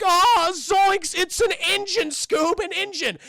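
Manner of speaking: high, loud, alarmed cartoon slacker; Shaggy. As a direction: yelp in panic as the cowardly sidekick. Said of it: "and Shaggy's like".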